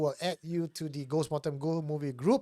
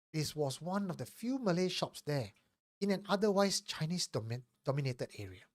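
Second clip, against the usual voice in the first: story narration; 3 semitones higher than usual. Acted. Reads a listener's confession.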